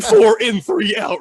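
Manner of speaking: high, loud counting of breaths; strained high counting of breaths; mock breathing count. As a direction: squeak out the breath count like panicking. Mimicking forced breathing count.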